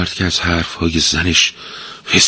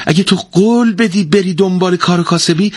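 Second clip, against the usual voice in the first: high, bright-hearted eager offer; a pleased wife promising the money; happy, delighted; 12 semitones higher than usual. A narrator's reading. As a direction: jump at the chance gladly. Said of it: "زن با خوشحالی گفت".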